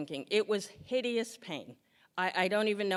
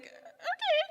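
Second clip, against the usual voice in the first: a very high, squeaky "okay". Retold or imitated. Dozing in the recliner, a squeaky high okay. Numbed out on Benadryl.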